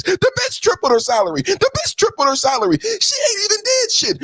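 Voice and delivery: high, hammed-up wailing sob; fake-cry the complaint way up high; wailing in mock grief. Mock crying over salary.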